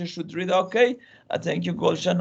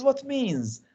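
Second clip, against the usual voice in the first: lightly acted student, higher pitch; a hint of a puzzled pupil's higher voice; questioning student; 7 semitones higher than usual. Student interrupts the teacher to ask about meaning, lightly acted questioning. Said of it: "one of the students says".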